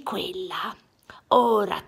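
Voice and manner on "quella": ordinary delivery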